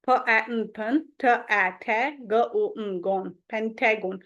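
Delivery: "फ, ए, न" and the rest as separate sounds, blended into pen-tae-gon; phonics sounding-out. Shown as a demonstration. Teacher decoding for a spelling trick, "पेंटागन" built up as pen-tae-gon. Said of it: "गलत pronunciation है".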